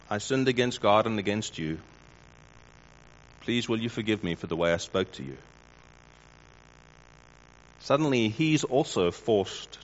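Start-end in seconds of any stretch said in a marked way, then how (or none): 0.1-5.4 soft, humble plea for forgiveness; gentle and earnest, asking pardon; sincere apology. Shown as a demonstration. Preacher modelling a sincere apology to a colleague, soft and humble. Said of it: "But if you say to him".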